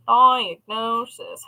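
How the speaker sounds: slow drawn-out diagnosis; drawn out slowly. Shown as a demonstration. Vlogger savoring the word diagnosis, said slowly. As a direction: stretch each syllable of diagnosis. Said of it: "How many times can I say diagnosis?".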